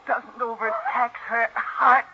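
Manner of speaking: fierce straining. Acted. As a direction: push through the words as you press down. Millie smothering aunt, strained.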